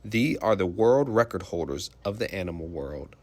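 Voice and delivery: normal talking voice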